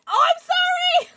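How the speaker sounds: very high, loud squeal; over-the-top squealed sorry; exclaimed apology. Real, not acted. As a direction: shriek the apology.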